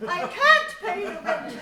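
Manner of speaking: high, loud pleading heroine; damsel in distress. Acted. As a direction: wail it like a helpless maiden.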